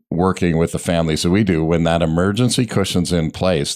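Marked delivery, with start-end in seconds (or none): none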